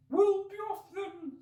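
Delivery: very high, slow, loud bird grumble; sulky pigeon. Acted. Pigeon dismissing Alice as it settles, sulky. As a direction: huff it off sulkily. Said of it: "said the pigeon in a sulky tone".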